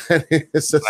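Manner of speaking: high-pitched, laughing lead-in. Real, not acted. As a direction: laugh your way into the words. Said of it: "I'm laughing 'cause".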